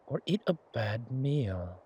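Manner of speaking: ordinary talk, nothing marked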